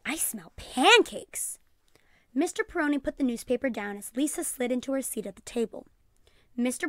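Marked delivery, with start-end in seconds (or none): 0.0-1.6 slow, hushed, sniffing the air. A narrator's reading. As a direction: savour the smell as you breathe in.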